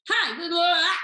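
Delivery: high, loud babbled greeting; over-eager agent pitch. Acted. Coach mimicking agent's opening call to seller, over-eager. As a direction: launch in eagerly, mocking the agent.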